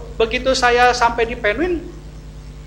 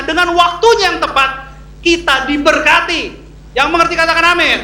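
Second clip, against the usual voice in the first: preaching at full force; 5 semitones higher, 7 dB louder than usual. Real, not acted. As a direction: proclaim it to the congregation, building to a loud finish.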